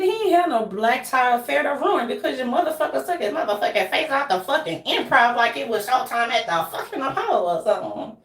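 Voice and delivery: ordinary talk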